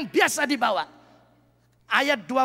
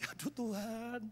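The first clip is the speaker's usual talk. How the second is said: soft, pleading prayer, imitating his past crying; retold tearful plea; 7 dB quieter than usual. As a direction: beg God quietly, half-sobbing in memory. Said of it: "saya malam jam enam nangis".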